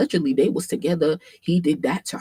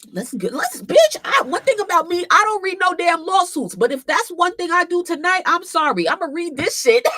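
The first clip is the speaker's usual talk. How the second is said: high, loud, giddy insistence; hyped-up declaration; 12 semitones higher, 5 dB louder than usual. Real, not acted. Streamer vowing to read the lawsuit, hyped-up declaration. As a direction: announce it at excited full volume.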